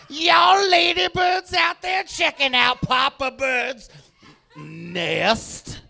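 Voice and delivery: a strutting bird wooing the ladies; seductive male bird. Acted. Comic voicing a male bird courting ladybirds, slow and crooning. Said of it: "He's like".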